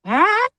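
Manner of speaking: short high rising cry; imitated owl screech. Storyteller demonstrating the sound from the woods, high mimicked screech. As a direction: mimic a screech owl's call. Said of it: "And it's like".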